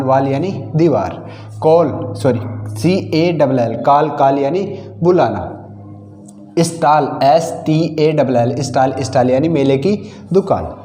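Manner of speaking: usual speaking voice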